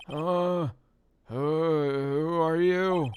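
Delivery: high, loud, drawn-out judge; startled judge. Acted.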